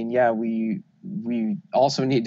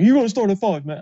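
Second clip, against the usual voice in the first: loud, much higher scolding shout; Genie impression; 10 semitones higher, 6 dB louder than usual. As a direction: yell it like an exasperated organizer. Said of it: "Is that a good Genie impression?".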